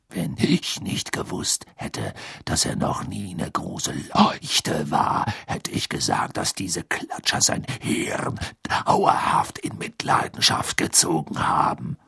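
low, slow rough whisper; dry muttered jab; gruff whispered aside. Acted. (tiefes raues Flüstern)

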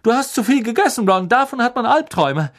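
fast, high, loud brush-off; puzzled prefect. A narrator's reading. Percy dismissing Ron's story, quick and loud. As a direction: dismiss the little brother briskly. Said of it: "sagte Percy, wenngleich verdutzt".

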